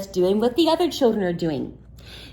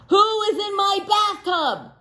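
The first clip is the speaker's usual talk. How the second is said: startled shout; 11 semitones higher than usual. Acted. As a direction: yell in surprise at the intruder. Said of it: "said someone".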